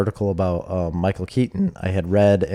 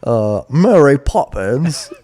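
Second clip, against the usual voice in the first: mock English accent; 4 semitones higher, 4 dB louder, slower than usual. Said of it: "chimney sweep voice".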